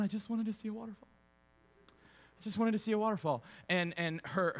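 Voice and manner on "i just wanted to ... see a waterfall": lightly voiced whiny complaint; mock a tired, disappointed sulk; whining wife. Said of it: "Melissa's just sitting there going".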